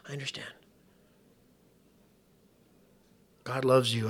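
soft, hushed reassurance; gentle comfort. Retold or imitated. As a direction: comfort her quietly, almost whispering. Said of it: "I said".

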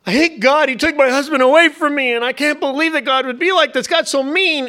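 high, loud, bitter wail against God; imitating a furious widow; grieving widow's outburst. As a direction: cry out as a grieving widow in anger. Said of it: "she'll say".

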